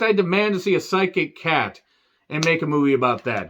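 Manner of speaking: normal talking voice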